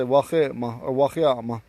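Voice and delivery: WACHEMA, WACHEAMA with a rasped "kh"; guttural "kh" in "wachema or wacheama"; throaty X. Shown as a demonstration. Teacher shows the intended plural forms, throaty fricative. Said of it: "it's in the back of your throat".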